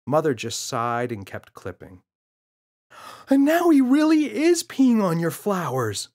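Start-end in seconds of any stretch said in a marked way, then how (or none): 3.3-6.1 put-on very high, loud child yell. Boy yelling to his mother at the rose bushes. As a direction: yell it across the garden. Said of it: "yelled James".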